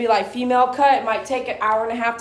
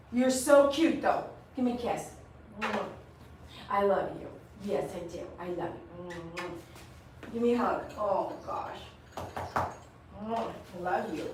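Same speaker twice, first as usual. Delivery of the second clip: softly cooing at her dog, much softer; tender cooing; 5 semitones lower, 10 dB quieter than usual. Real, not acted.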